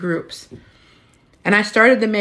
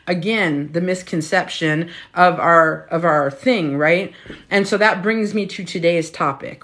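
ordinary talk, nothing marked

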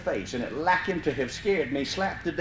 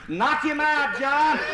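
calling up the tree; 9 semitones higher, slower than usual. Retold or imitated. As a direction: yell up to the treetop.

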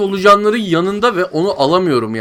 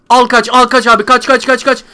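frantic urging; 6 semitones higher than usual. Real, not acted. Gamer fleeing worms with the cat, frantic shouting. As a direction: panic as the worms close in.

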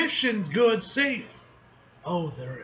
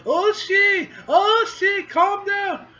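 high, loud shout of the name; calling out; 13 semitones higher, 7 dB louder, much slower than usual. Acted. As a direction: yell to stop the dragon. Character shouts at dragon.

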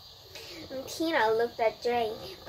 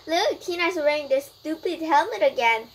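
teasing; 5 semitones higher, 6 dB louder than usual. A narrator's reading. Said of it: "teased Melissa".

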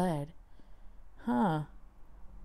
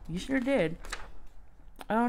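normal talking voice

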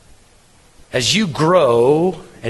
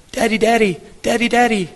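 put-on higher, eager little kid tugging at Daddy; little child calling; 8 semitones higher than usual. Preacher voicing his young kids asking for time, high eager calls. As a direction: call Daddy like a small child wanting attention.

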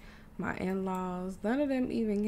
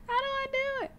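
very high, squeaky plea; squeaky helplessness; faster than usual. Real, not acted. Player who messed up the whole thing, squeaky and helpless. Stuck on how to do it.

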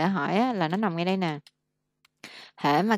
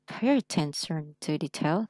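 slow, every sound of "Pay attention to details" clear; careful slow reading; slower than usual. Shown as a demonstration. Teacher modelling careful slow reading for a student. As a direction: read it slowly and precisely. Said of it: "Đọc phải đàng hoàng hết sức".

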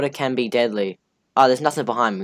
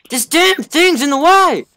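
frustrated yell; 8 dB louder, much slower than usual. Real, not acted. Stuck in the way, frustrated.

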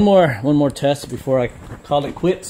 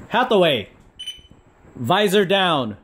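voice command; 6 semitones higher than usual. Real, not acted. Commanding the visor.

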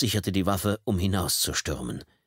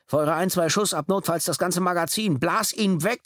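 yelled inner command; 10 semitones higher than usual. Acted. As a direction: scream at yourself to open fire. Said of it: "Er gab sich Befehle".

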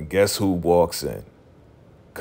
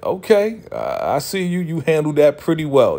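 teasing high praise; 7 semitones higher than usual. Retold or imitated. Friends teasing Issa after talk.

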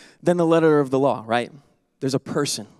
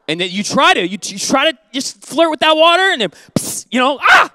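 mock yelp; 8 semitones higher, 6 dB louder than usual. Acting out getting burned.

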